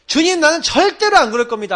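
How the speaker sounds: high, loud insistent promise; boastful loud vow. Retold or imitated. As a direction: proudly call out the vow, swearing never to deny him. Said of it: "하고 큰 소리 쳤던 사람이".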